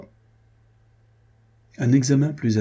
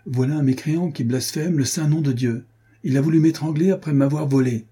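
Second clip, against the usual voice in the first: a lightly higher, plaintive sanctimonious voice; a touch of false piety, complaining; pious cheat pleading; 7 semitones higher than usual. A narrator's reading. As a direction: play the wronged holy man to the sergeant. Franz's pious plaintive accusation.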